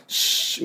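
hushed unvoiced shh; shushing sound. Shown as a demonstration. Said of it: "うるさいよって音ね".

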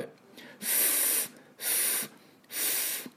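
ふーっ blown slow and unvoiced through teeth on lip; voiceless f hiss. Shown as a demonstration. Teacher showing the f friction to students, voiceless. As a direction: blow a long voiceless f. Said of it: "ふーっとこの擦れる".

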